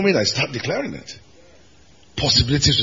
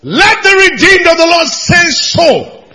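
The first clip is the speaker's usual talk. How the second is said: preaching shout; 10 dB louder than usual. Real, not acted. Preacher calling congregation to respond.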